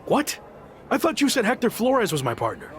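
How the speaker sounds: high, startled what; stunned by the news; shocked disbelief. Acted. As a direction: caught off guard, pitch jumping up. Shocked at surprise partner.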